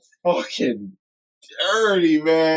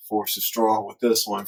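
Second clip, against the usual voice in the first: lower, softer Vader imitation; Vader quote; 7 semitones lower, 4 dB quieter than usual. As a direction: drop into a hushed Vader line. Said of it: "from Vader".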